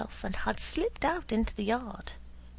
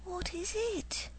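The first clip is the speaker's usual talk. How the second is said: hushed question; 11 semitones higher, 7 dB quieter than usual. A narrator's reading. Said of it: "Doodle repeated".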